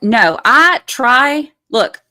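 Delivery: ordinary talk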